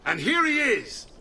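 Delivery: high, proud, festive welcome; proud announcement. Acted. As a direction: present the champion to the crowd. Uncle greets hero at celebration.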